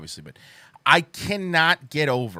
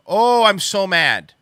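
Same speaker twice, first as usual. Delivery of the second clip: mad; 6 semitones higher, 7 dB louder than usual. Real, not acted.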